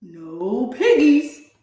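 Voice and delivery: slow, unbothered duck voice; deadpan duck. A narrator's reading. Duck refusing the witch, slow and unbothered. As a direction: say it like a stubborn duck. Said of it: "quacked the duck".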